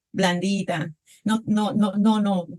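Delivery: ordinary talk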